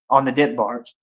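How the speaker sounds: usual speaking voice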